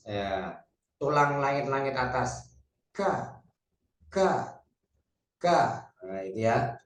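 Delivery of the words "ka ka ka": "Ka" with tongue back against the upper palate; "Ka" as a teacher's model, clear k; palate-placed kaf. Shown as a demonstration. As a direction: say "ka" as a light Arabic k, repeated separately. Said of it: "lebih dekat dengan langit-langit atas".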